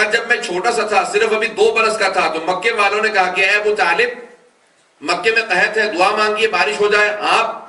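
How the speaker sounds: loud, rising sermon delivery; impassioned majlis narration; full-force preaching. Acted. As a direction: preach it out to the crowd, building. Preacher narrating the prophet's story.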